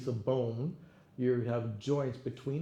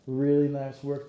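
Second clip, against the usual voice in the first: loud praise; 2 semitones higher, 9 dB louder than usual. Real, not acted. Coach praising the class.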